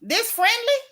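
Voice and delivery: high, rising disbelieving question; incredulous. Real, not acted. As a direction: ask it as if you cannot believe it. Repeats question after pause, disbelieving.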